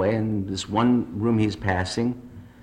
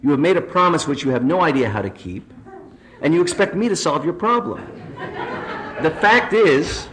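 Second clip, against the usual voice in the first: smug lecturing; 6 semitones higher than usual. Acted. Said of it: "replies the man on the ground".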